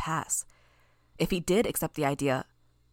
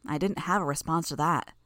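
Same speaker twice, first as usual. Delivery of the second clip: ordinary delivery, nothing marked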